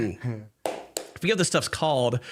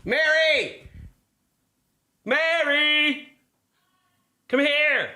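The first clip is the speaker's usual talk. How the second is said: calling out; 13 semitones higher, much slower than usual. Real, not acted. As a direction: shout a name to another room. Calling friend from another room.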